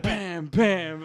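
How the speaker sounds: loud, high "bam, bam"; punchy mock hammer noise; imitated hammer banging. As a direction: bang out hammer blows with your voice. Imitating hammer on metal over speakerphone.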